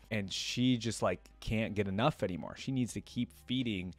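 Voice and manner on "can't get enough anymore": ordinary delivery, nothing marked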